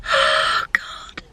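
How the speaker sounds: voiceless, tearful exhale; tearful sigh. Real, not acted. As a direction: sigh it out, near real tears. Upset, fighting tears about grandmother.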